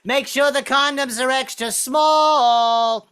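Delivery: high, slow, drawn-out call; yelling down. Retold or imitated. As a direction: belt it down from the window. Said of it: "yell down six stories".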